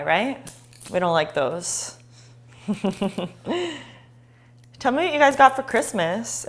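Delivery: ordinary talk, nothing marked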